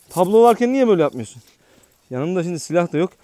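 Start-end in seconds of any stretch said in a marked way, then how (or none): 0.1-1.4 scolding. Real, not acted. Scolding the dog.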